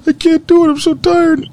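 very high, whiny complaining voice; high mock whine about being tired; whiny self-quote. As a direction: whine like a worn-out kid. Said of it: "I was like".

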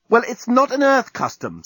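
high, indignant retort; indignant, pitched well up; furious. A narrator's reading. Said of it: "still furious".

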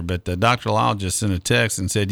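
usual speaking voice

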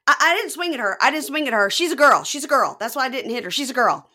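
flustered man shouting his innocence; defensive shouting. Retold or imitated. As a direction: protest loudly, insisting. Deontay defending himself in brawl.

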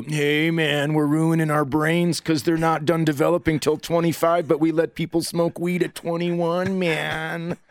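high, laid-back stoner dude; mocking how Coloradans would sound, hammed-up stoner. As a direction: play a spaced-out Colorado stoner. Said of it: "we don't go".

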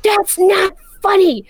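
high-pitched imitation of an upset child; mad and upset. Mom imitates son teased by dad, high frustrated protest. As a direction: protest like a frustrated little kid, slowing down. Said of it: "he gets so mad and up-, and upset".

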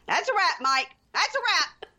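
high-pitched, called out to the crew; theatrical storming-off call; dismissive announcement. Acted. Acted storming off a shoot.

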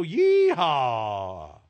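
long, drawn-out cowboy yeehaw; whooping game-show rodeo call; rodeo holler. Pretend. Rodeo catchphrase hollered by host.

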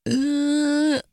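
loud high protesting groan. Real, not acted. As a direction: groan out in dismay. Groan at relationship teasing.

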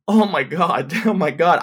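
mock hyperventilating "oh my God"; acted panic. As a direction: play someone starting to panic. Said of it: "I'm hyperventilating".

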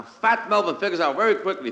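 ordinary delivery

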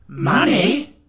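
high, loud astonished Money?; amazed cry. Acted. Friends cry out at Tip's idea, high amazed. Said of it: "they all cried in an amazed chorus".

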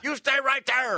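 high-pitched screamed alarm; screaming. Acted. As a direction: scream for help at an intruder. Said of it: "I will start screaming".

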